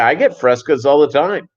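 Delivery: usual speaking voice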